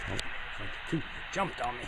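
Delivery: normal talking voice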